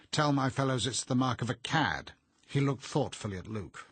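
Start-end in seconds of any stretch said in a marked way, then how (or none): 0.1-2.1 loud, blustering schoolmaster; hearty schoolmaster. A narrator's reading. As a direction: boom it like a proud headmaster. Arthur's voice.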